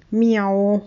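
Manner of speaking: plaintive little cat mew; drawn-out meow of a cat; cat's meow. A narrator's reading. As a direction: imitate a cat mewing. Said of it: "maunzt Pirat".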